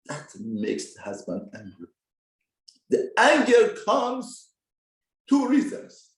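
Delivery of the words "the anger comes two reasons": higher, louder, slow burst; emphatic outburst. Real, not acted.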